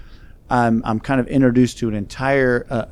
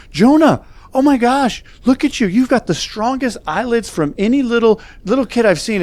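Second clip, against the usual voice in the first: put-on very high, excited baby-praise voice; gushing grandma; 11 semitones higher than usual. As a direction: imitate a grandmother marvelling at her baby grandson. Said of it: "And she's like".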